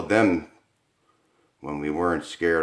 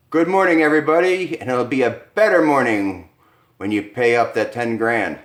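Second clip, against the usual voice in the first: much higher, loud gloating host; gloating greeting; 4 semitones higher, 6 dB louder than usual. Acted. Bettor greeting the couple at dawn, loud gloating voice. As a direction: crow over the bet.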